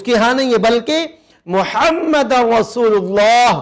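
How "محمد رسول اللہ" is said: slow, careful محمد with the throaty ح; slow demonstration. Shown as a demonstration. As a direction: drag out محمد to show the ح sound. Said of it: "یہ محمد والی ہا ہے".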